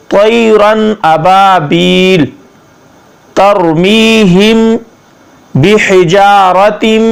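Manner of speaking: slow word-by-word tajweed; slow Arabic recitation for learners; Quranic recitation lesson. Shown as a demonstration. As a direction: draw out the long vowels, pause after each phrase. Models the verse.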